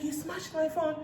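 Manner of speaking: high, softened imitation of outrage; mock outraged disbelief. Recapper mimics a woman whose phone was smashed, outraged. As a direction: retell an indignant question, pitched high but quiet.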